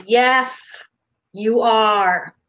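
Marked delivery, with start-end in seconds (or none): none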